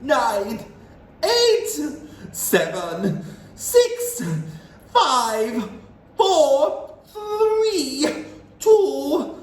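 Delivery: high, loud cackling countdown; witch's countdown. A narrator's reading. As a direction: count down with wicked glee. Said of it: "cried the Grand High Witch".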